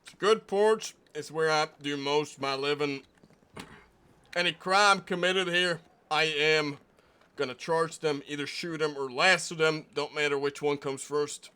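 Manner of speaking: a proud small-town deputy guarding his porch; stern deputy marshal. Acted. Plays deputy marshal character.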